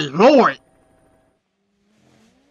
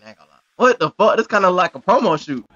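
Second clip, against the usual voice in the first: usual speaking voice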